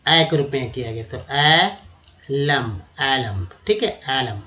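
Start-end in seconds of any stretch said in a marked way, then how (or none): 1.1-2.8 एलम stretched slowly, "e-lum"; very slow demo. Shown as a demonstration. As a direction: hold out एलम for the class. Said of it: "ए का उच्चारण जो है यहाँ पर". (एलम को बहुत धीरे बोलें)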